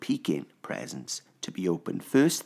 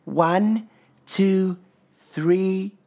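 put-on child voice; 13 semitones higher, 5 dB louder, much slower than usual. A narrator's reading. Little Pip counting.

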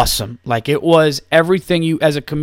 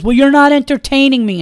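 much higher and louder, bratty and mocking; snotty retort; 12 semitones higher, 6 dB louder than usual. Drunk heckler sassing the comedian onstage, snotty and mocking. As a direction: play the entitled drunk heckler.

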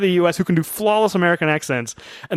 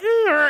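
high squealing dial-up noise; quick mock modem sound; imitated modem screech. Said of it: "you hear a modem sound like".

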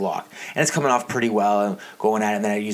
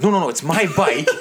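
flustered protest; 6 semitones higher, 4 dB louder than usual. Retold or imitated. Protesting to suspicious store lady.

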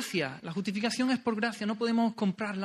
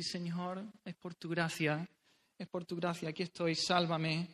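much softer, a beggar pleading before God; humble whispered prayer; 3 semitones lower, 9 dB quieter than usual. Acted. Preacher voicing a sinner's prayer to God, soft and humble. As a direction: lay it down quietly like a beggar asking for mercy.

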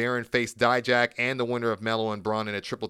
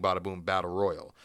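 ordinary delivery

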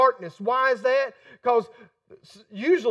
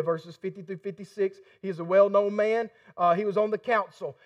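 ordinary talk

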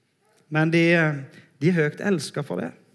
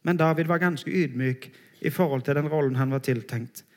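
ordinary delivery

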